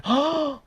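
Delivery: sudden high gasp; high, startled intake of breath; sharp gasp. Real, not acted. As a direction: gasp mid-sentence. Gasp breaks off the read line.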